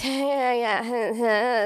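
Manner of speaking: mock slurred drunk blubbering; garbled tipsy mumble, played for laughs; drunken babble. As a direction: babble incoherently like a weepy drunk. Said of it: "It's probably what I said".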